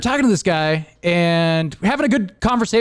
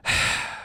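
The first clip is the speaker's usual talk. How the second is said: weary sigh; 7 dB quieter than usual. Retold or imitated. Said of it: "And you're like".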